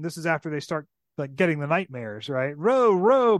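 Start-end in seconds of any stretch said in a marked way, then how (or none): 2.6-3.4 loud, high shouted order; bellowed. Acted. Caspian shouts at his rowers in panic, bellowing. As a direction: bark the oars into motion. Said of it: "bellowed Caspian".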